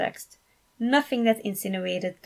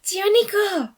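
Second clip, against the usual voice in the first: put-on high, loud excited call of a teen girl; a girl shouting a happy greeting; 12 semitones higher, 6 dB louder than usual. A narrator's reading. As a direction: call across the room to your uncle, thrilled. Niece calling out to uncle.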